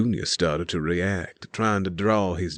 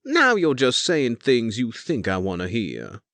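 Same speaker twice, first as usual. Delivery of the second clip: pouting; 5 semitones higher, 3 dB louder than usual. Acted. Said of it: "Elise pouted".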